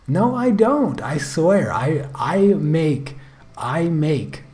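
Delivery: sudden high, loud protest. Real, not acted. Streamer denies mixing up the names, loud high protest. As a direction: jump up loud to deny it. Denying he says wife's name alike.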